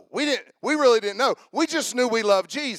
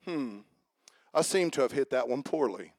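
low, soft, mock-polite golfer; prim understatement; 9 semitones lower, 8 dB quieter than usual. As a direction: play it dry and overly proper after a terrible shot. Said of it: "do you say".

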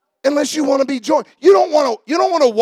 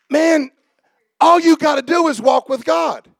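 preaching at full force; 3 semitones higher than usual. Acted. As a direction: build the congregation up with fervor.

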